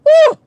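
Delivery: loud high "woo"; piercing cry of exasperation; exasperated whoop. Real, not acted. Exasperated outburst.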